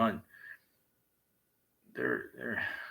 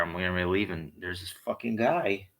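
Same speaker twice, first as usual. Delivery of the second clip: ordinary talk, nothing marked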